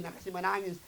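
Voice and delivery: ordinary talk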